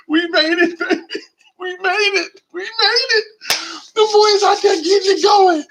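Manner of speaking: very high, overjoyed squeal; hammed-up weepy celebration; mock tearful joy. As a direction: squeal it as if about to cry with happiness. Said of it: "I'm gonna cry".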